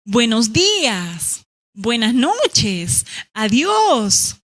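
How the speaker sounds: slow drawn-out greetings; leisurely hello and goodbye; greeting slowly. Shown as a demonstration. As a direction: stretch each greeting out. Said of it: "O decirle".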